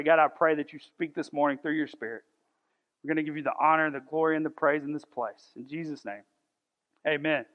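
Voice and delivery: low, soft praying voice; public prayer. Real, not acted. Preacher asks God to speak this morning, low soft prayer. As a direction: pray quietly, lowering the voice to the amen.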